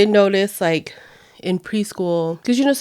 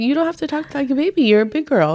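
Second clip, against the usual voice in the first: quick mom lecture; 7 semitones higher, faster than usual. Retold or imitated. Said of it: "I was like".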